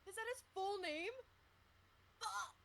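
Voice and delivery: high loud disbelief; shocked. Real, not acted. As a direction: blurt it out in shock. Shocked by a name.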